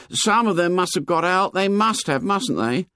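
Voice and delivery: put-on high-pitched, earnest boy's voice; high boyish character voice; fat schoolboy. A narrator's reading. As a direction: play an anxious plump schoolboy, pitched high.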